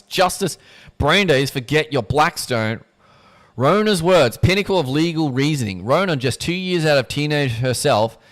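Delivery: loud, rousing admiration; excited praise. Acted. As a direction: narrator cheers Rona's judgment, loud and rousing. Narrator's excited praise of verdict.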